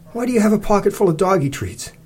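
high-pitched baffled question; incredulous. A narrator's reading. Narrator asking Cece about her treats, incredulous.

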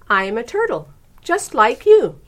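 friendly voice. A narrator's reading. Myrtle to the rude turtle, friendly voice. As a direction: offer it kindly, rising high on the end. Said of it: "in a friendly voice".

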